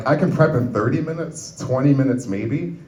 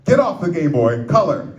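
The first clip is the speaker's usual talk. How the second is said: higher, scolding mock yell; acted yell; 5 semitones higher, 4 dB louder than usual. Uncle to nephew still playing the game, mock yelling. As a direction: yell it as an order at a child. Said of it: "so I yell".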